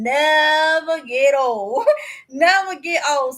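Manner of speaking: laughing. Real, not acted. Laughing about line dances.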